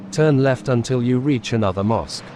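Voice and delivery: ordinary talk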